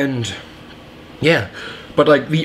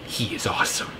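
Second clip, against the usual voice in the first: hushed, breathy whisper; whispered aside; 4 semitones lower, 11 dB quieter than usual. Real, not acted. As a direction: drop to a secret whisper. Aside about Vision.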